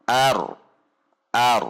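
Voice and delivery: "ar" with the r neither cut off nor flowing on; half-held r. Shown as a demonstration. Teacher models r with sukun, "ar" half held. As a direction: end "ar" on a brief, lightly held r. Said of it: "dibaca tidak langsung terhentak".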